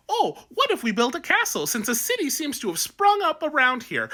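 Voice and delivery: high-pitched voice of sudden inspiration; high mock-musing conqueror; Aegon having an idea. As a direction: put on a high, comic king voice thinking aloud. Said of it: "Egan had the idea".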